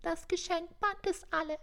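high, tiny piping voice; thin squeaky elf. A narrator's reading. Frightened elf reporting to Santa, piping thinly. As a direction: squeak it like a timid little elf. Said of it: "piepste mit dünnem Stimmchen".

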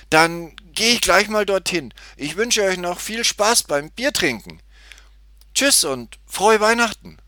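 put-on high, loud, eager girl; cheery goodbye. A narrator's reading. Tutti takes leave of the two men, high and cheery. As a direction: call a happy farewell in a high child's voice.

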